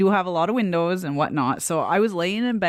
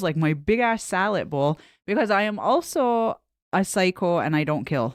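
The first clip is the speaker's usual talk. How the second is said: ordinary talk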